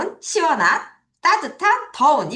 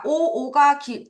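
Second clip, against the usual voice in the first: ordinary delivery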